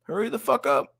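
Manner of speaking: hammed-up nagging to have a kid; mock impatient urging. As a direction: biological clock nagging her, mock impatient. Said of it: "They're like".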